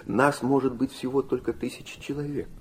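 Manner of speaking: ordinary talk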